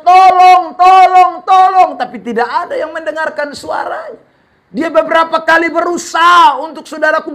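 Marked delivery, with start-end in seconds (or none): 0.0-1.9 high, loud repeated cries for help; stranded man yells into the empty night; screaming for help. Retold or imitated. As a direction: scream it as loud as you can. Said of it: "Dia berteriak sekencang-kencangnya".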